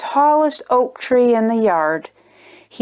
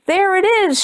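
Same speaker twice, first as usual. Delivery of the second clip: excited call, higher; pointing the trailer out to grandpa; shouted; 8 semitones higher than usual. A narrator's reading. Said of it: "shouted Doodle".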